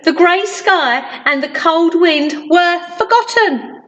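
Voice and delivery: usual speaking voice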